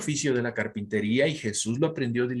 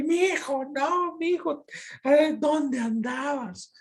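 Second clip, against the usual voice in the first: wailing mother; 14 semitones higher, slower than usual. Retold or imitated. María wailing for lost son.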